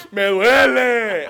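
high, loud, slow drawn-out mock cry; loud slow hammed-up wail of pain; mock scream. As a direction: stretch out a hammed-up yell of pain. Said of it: "Empieza a gritar así".

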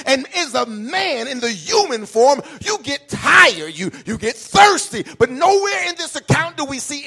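loud, rising sermon cry; climbing preacher's shout; full-force preaching. Acted. Pastor building to a preaching peak.

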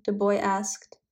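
usual speaking voice

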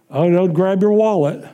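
usual speaking voice

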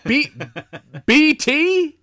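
very high, loud incredulous echo; hammed-up disbelief at the odd name; Tom Atkins impression. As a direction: repeat the nickname as a baffled question. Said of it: "inflection is so spot on for him".

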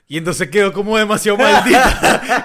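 laughing through the words; can barely get it out for laughing. Real, not acted. Telling a friend about the quitting coworker, laughing loud.

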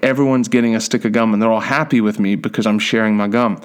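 ordinary delivery

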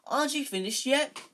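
loud, high, disbelieving call; incredulous call. Acted. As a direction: shout it across to the pitch in disbelief. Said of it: "Ron called incredulously".

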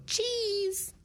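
high, long squeaky cheese for the camera; hammed-up camera cheese; photo cheese. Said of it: "Like, in a photo".

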